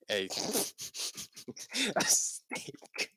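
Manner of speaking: giggling out the words; cracking up mid-read; laughing through it. Real, not acted. Laughing while reading a fanfic aloud.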